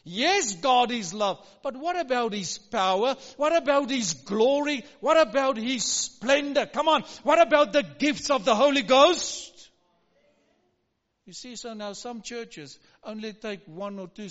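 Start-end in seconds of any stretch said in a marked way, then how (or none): 0.1-9.7 full-force preaching. Acted. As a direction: proclaim it to the congregation. Rising sermon climax.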